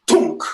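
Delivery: sudden loud 'tunk' sound effect; brain glitch noise. Retold or imitated. Wife's brain bugs out, sudden imitated clunk. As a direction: pop out one abrupt, loud clunk. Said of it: "my wife's brain".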